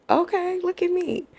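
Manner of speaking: high, proud and pleased; squeaky proud look at me; proud excitement. Retold or imitated. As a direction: show off a little, delighted. Proud after getting hired.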